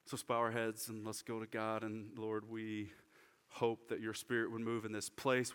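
bow your head and pray gently to God; quiet prayer. Real, not acted. Pastor leads congregation in prayer.

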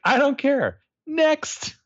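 high, loud bored brush-off; mocking the counsellor telling him to leave; dismissive Mona. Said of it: "And she's like".

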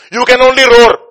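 high, full-force shout at the devil; defiant taunt. Acted. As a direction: yell it down defiantly.

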